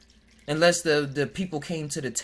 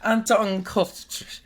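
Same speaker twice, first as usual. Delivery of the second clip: slow, higher mock German reading; mock German name; 7 semitones higher, 4 dB louder, slower than usual. To the viewers, slow mock German pronunciation. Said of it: "it looked like that says Ashton Kutcher in German".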